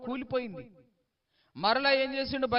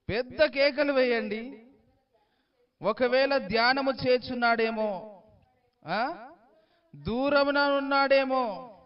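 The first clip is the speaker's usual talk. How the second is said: higher, louder slow taunting; mocking; 6 semitones higher, 5 dB louder than usual. Elijah ridiculing the Baal prophets, slow mocking taunt. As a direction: ridicule them, drawing each guess out. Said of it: "అపహాస్యము చేసి మాట్లాడుతున్నాడు".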